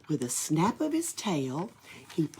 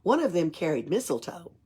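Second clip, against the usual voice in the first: ordinary delivery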